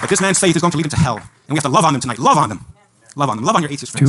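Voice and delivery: loud, pleading, hammering love on them; impassioned preaching. Acted. Preacher urging believers to love atheist friends, loud and pleading. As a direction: plead with the crowd at full volume. Passionate preaching climax to crowd.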